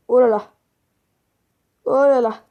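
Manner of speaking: loud disgusted groan at a stench; smelling something awful. Real, not acted. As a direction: react to a terrible smell. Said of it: "ça pue".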